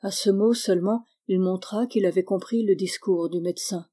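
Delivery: plain reading voice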